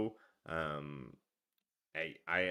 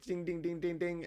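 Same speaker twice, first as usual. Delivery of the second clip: coin chime sound; 10 semitones higher than usual. Retold or imitated. Imitating Sonic coin sound.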